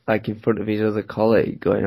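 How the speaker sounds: ordinary delivery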